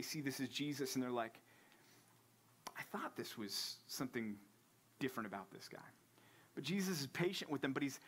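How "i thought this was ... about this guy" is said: hushed whisper of realization; awed whisper. Retold or imitated. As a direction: breathe it out in wonder. Disciples realizing it's Jesus.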